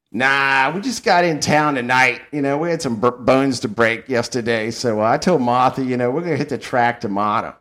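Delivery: put-on like an old guy at a Saratoga bar, in a New York accent. Old dude at the bar telling his plans, New York accent. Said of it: "And the Northern accent".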